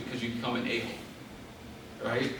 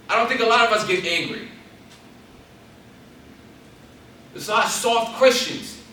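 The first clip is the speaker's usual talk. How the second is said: higher, louder driving sermon; preaching at full force; 6 semitones higher, 6 dB louder than usual. Real, not acted. Preacher urging the church to use anger, full force. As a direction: hammer it home to the church.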